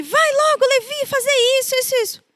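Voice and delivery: put-on high, rapid-fire scolding of a child; scolding mother. Mother ordering her son to do chores, scolding. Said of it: "quando eu brigava com ele".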